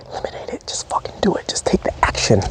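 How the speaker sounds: hushed, urgent whisper; intense whisper. Acted. Coach to viewer, urging action in a fierce whisper. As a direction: lean in and breathe the words, pushing them to act.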